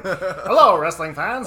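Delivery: put-on loud, high old-time radio announcer; 1940s baseball announcer. Shown as a demonstration. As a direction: boom it like a vintage sportscaster. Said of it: "it sounds like an old, like, nineteen forties, like, baseball announcer".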